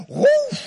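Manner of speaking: high, loud whoosh of fire bursting out; sudden rushing fire noise; whoosh sound effect. Pretend. Fire of God comes out.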